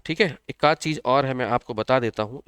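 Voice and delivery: ordinary talk, nothing marked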